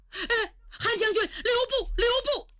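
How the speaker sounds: high, frightened calling out; terrified minister crying stop; panicked plea. Acted. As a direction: beg him to stay, voice cracking. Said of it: "萧思文吓坏".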